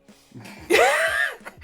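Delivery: very high loud laugh; real shrieking laugh. Real, not acted. Joke about therapy.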